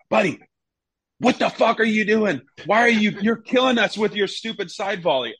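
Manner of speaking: hammed-up halftime telling-off; mock manager rant. Manager scolding goalkeeper at halftime, mock angry rant. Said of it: "He just goes".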